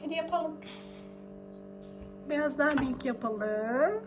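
usual speaking voice

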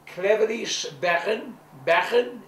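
"Cleverish bergen" slow and loud in Dutch; Dutch word demo. Shown as a demonstration. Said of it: "give A a little Dutch lesson".

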